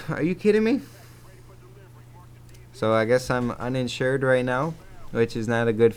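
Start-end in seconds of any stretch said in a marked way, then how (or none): 0.1-0.8 pitched way up in disbelief; a retold gasp of outrage on the phone; incredulous. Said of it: "I was like".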